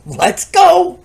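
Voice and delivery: high, loud eager burst; eager relief. Acted. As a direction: jump at the chance. Eager to go to jail.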